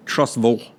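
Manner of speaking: Trussville, low and soft, ending in a dark "vul"; local pronunciation. Shown as a demonstration. Host demonstrates his friend's way, Trussville with a "vul" ending. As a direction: say Trussville with "ville" swallowed like "vole". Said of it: "Like the ville is a vole".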